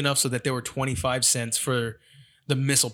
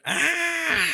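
put-on toddler yell. His youngest pointing at the fridge, high toddler yell. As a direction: yell like a demanding little kid. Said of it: "and he goes".

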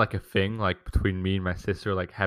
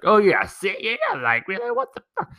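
much higher, snide imitation of his sister; mocking sister; 10 semitones higher than usual. Retelling his sister taunting him at dinner, high and snide.

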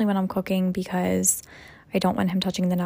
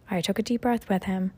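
ordinary talk

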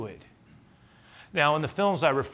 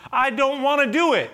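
desperate mock pleading; 11 semitones higher, 6 dB louder than usual. Moses begging the Lord to send another, pleading. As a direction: beg hard, hammed-up and whiny. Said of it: "But Moses pleaded".

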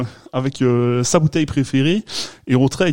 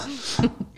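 petit rire aigu retenu; rire aigu étouffé; 12 semitones higher, 9 dB quieter than usual. Real, not acted. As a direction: pouffer doucement. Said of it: "tu rigoles, je t'entends". (rire étouffé en entendant l'accent)

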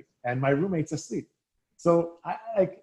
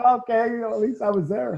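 higher, wry self-mocking tone; wry shrug; 8 semitones higher than usual. Player mocks his bench-only debut, wry and higher. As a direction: shrug it off with irony.

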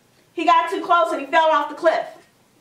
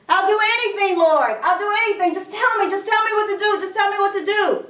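higher-pitched, urgent acted begging; pleading; 5 semitones higher, faster than usual. Desperate Jack begging God for instructions, pleading. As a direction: beg in a rush of repeated pleas. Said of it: "he started pleading".

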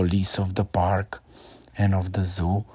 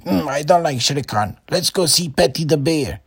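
put-on little boy; 7 semitones higher, 5 dB louder than usual. A narrator's reading. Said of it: "still went and said".

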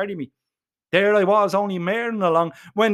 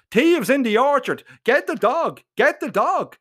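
put-on old man shouting; 7 semitones higher than usual. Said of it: "he shouts".

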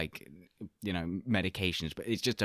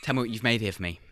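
Gordon Ramsay impression; 4 semitones higher than usual. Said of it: "It's like Gordon Ramsay".

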